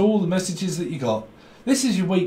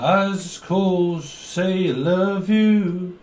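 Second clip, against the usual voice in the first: put-on slow drunk declaration; 5 semitones higher, 3 dB louder, slower than usual. Drunk caller slurring a love line.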